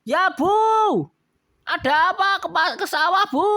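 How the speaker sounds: high, loud husband calling back; Pak Juli answering. Acted. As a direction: shout back to his wife across the field. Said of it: "kata Pak Julinya". (Pak Juli membalas teriak dengan suara tinggi)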